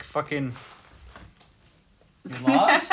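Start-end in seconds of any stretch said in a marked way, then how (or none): 2.3-2.9 high, loud creepy stranger; creepy guy voice. Retold or imitated. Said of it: "Some guy went".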